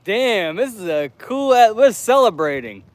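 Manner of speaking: high mock excitement; mock excited. Mocking the celebratory sign, high and excited. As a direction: hype it up like a party.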